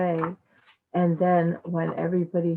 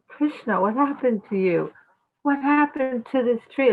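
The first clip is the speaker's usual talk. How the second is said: raised pitch, worried father asking; father's alarmed questions; 6 semitones higher than usual. Acted. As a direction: call out to the boy in a higher, anxious voice. Father finds Krishna tied up.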